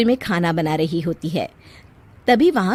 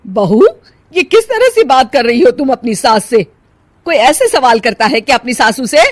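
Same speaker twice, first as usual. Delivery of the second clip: loud scolding mother-in-law; wicked stepmother; 5 semitones higher, 8 dB louder than usual. Acted. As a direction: scold her sharply, loud and high.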